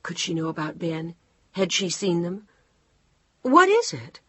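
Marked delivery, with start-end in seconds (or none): 3.4-4.2 higher, louder anxious demand; alarmed question. Acted.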